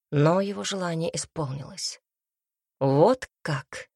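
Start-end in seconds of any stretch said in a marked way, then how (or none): 2.8-3.9 loud, drawn-out taunt; mocking question. A narrator's reading. Man answering the woman who mocked his wish, sneering. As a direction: toss it back with a sneer. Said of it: "спросил он с издёвкой".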